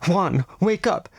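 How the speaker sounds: high, slowed urgent call; impatient cry. A narrator's reading. Nadia shaking her sleeping brother awake, impatient. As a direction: rouse him, no patience left. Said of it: "she cried impatiently".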